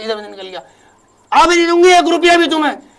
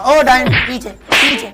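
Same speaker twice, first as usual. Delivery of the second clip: angry shout; slower than usual. Acted. Mother turns on daughter-in-law.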